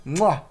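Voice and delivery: loud smacking kiss sound; chef's kiss smack. Acted. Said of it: "chef's kiss".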